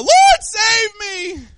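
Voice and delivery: high, loud, drawn-out desperate cry; cry for help. Retold or imitated. Peter sinking in the waves cries out, high and loud. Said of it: "Peter cried out".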